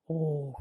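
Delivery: long, soft "ohh" of relief; imitated sigh of relief; relieved sigh. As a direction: let out a drawn-out sigh, acting out relief. Retold relief on seeing the sun.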